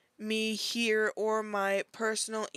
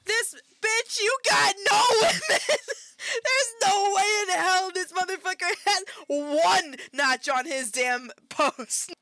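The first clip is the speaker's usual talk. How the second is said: loud, high, incredulous yelling; outraged disbelief; 9 semitones higher, 7 dB louder than usual. Real, not acted. Reacting to the quote he just read, outraged shouting.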